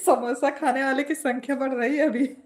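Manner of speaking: giggling through the words; laughing. Real, not acted. Laughing to viewers about the samosa crowd. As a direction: laugh it out, amused.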